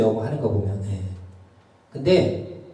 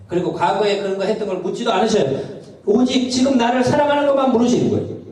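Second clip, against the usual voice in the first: fervent preaching; 7 semitones higher, 3 dB louder than usual. Acted. Preaching climax.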